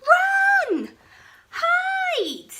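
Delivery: high, loud panicked alarm; cried out. Acted. Bonehead yelling a false alarm to his friends, high and loud. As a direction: shriek the warning to scatter everyone. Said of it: "Bonehead cried".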